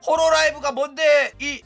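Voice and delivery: loud, high-pitched scornful demand; mock irate viewer. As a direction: act out a clueless viewer lashing out. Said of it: "막 이러는 거야". (높고 크게 따져 묻는 시청자 흉내)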